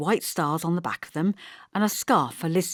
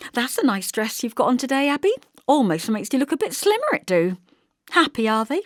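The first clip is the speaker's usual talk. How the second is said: high, sly needling sweetness; backhanded compliment; 7 semitones higher, 4 dB louder than usual. A narrator's reading. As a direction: slip the dig in sweetly. Backhanded compliment then pry.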